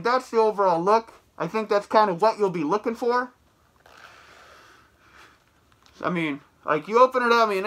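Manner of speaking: muffled behind latex mask; speaking inside rubber mask; through a face mask. Real, not acted. Seen 1: Full overhead mask covers mouth throughout; references show it held, unworn.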